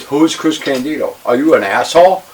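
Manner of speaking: incredulous scorn. Retold or imitated. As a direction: throw it out in loud disbelief. Scornful retelling of rookie's ignorance.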